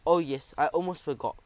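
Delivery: usual speaking voice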